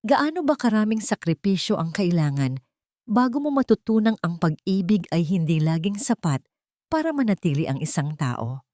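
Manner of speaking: ordinary delivery, nothing marked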